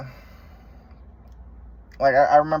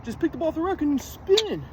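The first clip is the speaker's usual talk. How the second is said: much higher, put-on voice of a dismissive dad; dad telling his son to just spin; dad's voice; 10 semitones higher, slower than usual. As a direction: mimic dad brushing it off as easy. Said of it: "my dad just thought".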